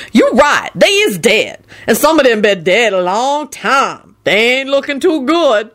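high, slow ominous drawl; ominous proclamation. A narrator's reading. Lucretia judging the long-dead bodies, ominous. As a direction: stretch it out as a dire pronouncement. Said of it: "she proclaimed ominously".

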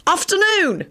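high, cheerful called greeting; cheery call. Acted. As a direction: call it out brightly. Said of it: "Sirius called out cheerily".

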